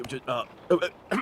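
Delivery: ordinary talk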